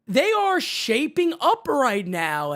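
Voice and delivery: drawn out, leaning on every word; slow it down and let it sink in; slow emphatic. Real, not acted. Host repeating his Yankees point, slow and emphatic.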